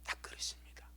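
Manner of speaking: voiceless whisper; sudden whispered aside. Real, not acted. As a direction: breathe it confidentially. Preacher whispers.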